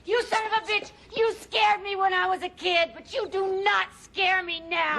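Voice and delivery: high-pitched, angry defiance; defiant, enraged accusation; furious confrontation. Acted. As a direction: hurl it at them, pitch held high.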